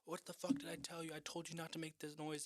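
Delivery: angry mom. Retold or imitated. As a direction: play the fed-up mother catching her kid again. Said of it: "and she's like".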